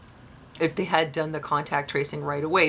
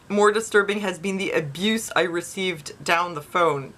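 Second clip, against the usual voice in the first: ordinary talk, nothing marked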